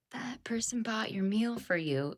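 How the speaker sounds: imitating the cashier's slow whisper. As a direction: breathe it out softly and slowly, like a secret. Drive-thru girl telling the angry man.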